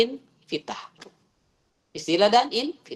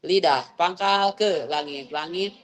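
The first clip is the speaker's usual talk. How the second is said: slow explaining; slower than usual. Shown as a demonstration. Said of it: "Kalau kaf".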